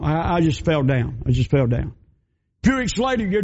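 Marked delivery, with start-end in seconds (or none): 0.0-1.9 lower, hesitant stammer of a woman hiding the truth; timid battered woman. Acted. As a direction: stammer the excuse, eyes down. Mary repeating excuse.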